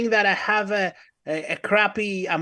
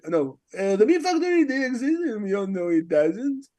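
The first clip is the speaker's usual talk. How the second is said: Artie impression. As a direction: imitate someone posing a riddle. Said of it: "give us your Artie impression".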